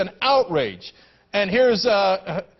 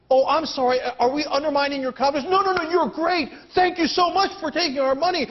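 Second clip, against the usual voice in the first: higher, mock-sweet groveling; sarcastic fawning over the bankers; 6 semitones higher than usual. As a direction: gush with fake gratitude.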